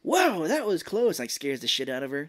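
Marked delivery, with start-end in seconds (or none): 0.0-1.2 Michelangelo. Retold or imitated. Acts out Michelangelo popping up.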